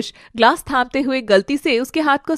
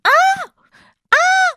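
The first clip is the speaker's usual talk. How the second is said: piercing, very high-pitched scream; two short high screams. Acted. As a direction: shriek in terror. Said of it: "आरोही की चीख".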